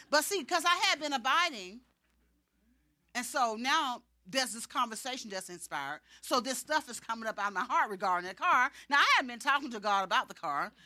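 ordinary talk

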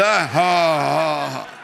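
deliberate, drawn-out ha, ha; put-on heavenly laugh at mid pitch; God laughing. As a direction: imitate a big laugh, dropped from the high pitch. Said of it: "I hope it's not a high pitch".

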